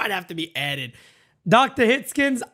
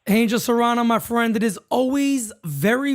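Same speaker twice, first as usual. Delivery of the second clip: ordinary delivery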